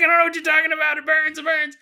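high, loud hammed-up yell of pain; mock anguished shout. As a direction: cry out as the fire burns. Damned soul burning in hell.

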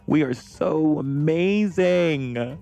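high and slow on amazing; swooning sing-song; drawn-out gush. Real, not acted. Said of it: "repeated takes".

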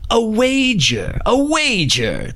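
high, slow, gleeful call of a bet; eager wager. Acted. Autumn proposes a bet.

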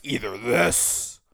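low, forced, put-on speaking voice. Shown as a demonstration. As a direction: push the voice down into a forced low register. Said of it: "this, like, weird, forced way of speaking".

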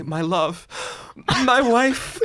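lightly voiced stage Romeo; a hint of tragic Romeo; Romeo line. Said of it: "I had to say".

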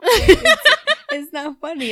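high, loud burst of laughter; cracking up while protesting; breaking into loud laughter mid-protest; laughing. Real, not acted.

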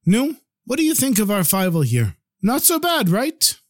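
a sly, pleased rebbe fishing for agreement; playful pride. Acted. Rebbe teases the two brothers about Feivel, playfully proud.

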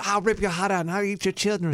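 a lightly voiced Tyson threat; hint of Tyson's menace; Tyson quote impression. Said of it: "Do you remember when he said".

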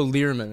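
Learman said as LEER-min; the name Lerman misread with a long ee; common mispronunciation. Retold or imitated. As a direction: say Learman with "ear" in the first syllable. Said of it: "A lot of people go".

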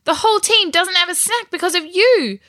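high, loud indignant scolding; angry blaming. A narrator's reading. Ruby blames her brother before the team, angrily. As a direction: pin the blame on him.

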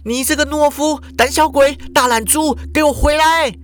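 put-on high, angry girl's shout; high furious name-calling; yelling insults. A narrator's reading. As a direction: call him back with insults. Shuhua yelling after fleeing Ikeda.

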